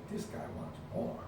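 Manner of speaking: hushed disbelief; quiet astonished aside; surprised whisper. Retold or imitated. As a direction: whisper it amazed. Opponent surprised, quoted.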